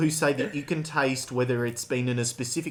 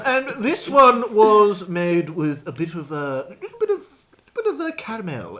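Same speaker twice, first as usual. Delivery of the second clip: mock wine expert; 7 semitones higher, 6 dB louder than usual. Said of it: "you get the average".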